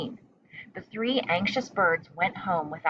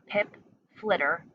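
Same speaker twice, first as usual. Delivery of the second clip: plain reading voice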